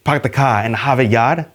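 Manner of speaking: playful mock Boston accent, r's dropped; a light Boston accent put on a Vulcan; Spock with Boston accent. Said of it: "imagine Spock saying something like".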